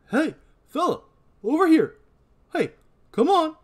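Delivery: high, loud calling out to a stranger. Acted. Deputy calling out to a man with a pitchfork. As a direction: shout it across the yard.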